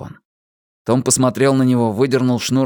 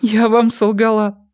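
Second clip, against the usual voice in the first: sniffling; 12 semitones higher, 5 dB louder than usual. A narrator's reading. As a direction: sniff through the words. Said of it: "шмыгая носом".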